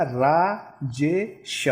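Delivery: रा-जे-श split slowly into रा, जे, श; syllable by syllable. Shown as a demonstration. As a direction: spell out राजेश one slow syllable at a time. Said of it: "मैं कैसे लिखूँगा".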